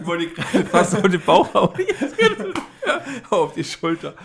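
giggling while talking; laughing. Real, not acted. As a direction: laugh through the confession. Laughing at own clumsiness.

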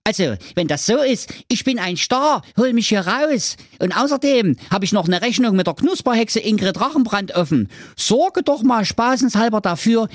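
high, eager hero giving orders; demanding Schwerthelm. A narrator's reading. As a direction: rattle off your wish in a high character voice. (fordernder Held, hohe Stimme)

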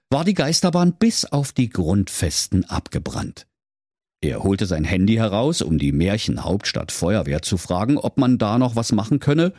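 usual speaking voice

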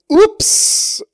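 mock surprised ups. Geld fällt beim Armschütteln raus.

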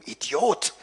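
ordinary delivery, nothing marked

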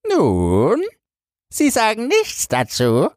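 high, wheedling weaver voice; a cunning cheat prodding the minister; sly swindler. Acted.

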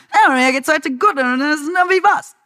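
exaggerated dubbing-studio German; dubbed German. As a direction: ham it up like a German dub of a sitcom. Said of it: "Dann sagst du".

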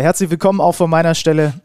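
usual speaking voice

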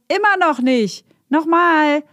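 put-on high-pitched kids' call, voice thrown out; children calling out. Granny hears kids shouting outside, imitated high-pitched call. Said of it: "rufen gehört hat".

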